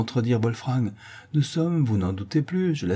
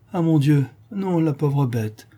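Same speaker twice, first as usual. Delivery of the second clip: naive; 5 semitones higher than usual. A narrator's reading. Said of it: "répond naïvement".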